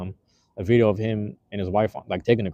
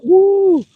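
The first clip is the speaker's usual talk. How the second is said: loud high whoop; excited whoop; 11 dB louder than usual. Real, not acted. Showing off the fresh haircut to viewers, a loud excited whoop. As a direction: let out a big whoop.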